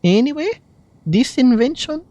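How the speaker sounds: lightly Scottish Venti voice, playful; a gentle Scottish lilt as the bard; Scottish accent. Acted. Streamer voicing Venti's lines, in a light Scottish accent. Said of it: "Scottish Venti".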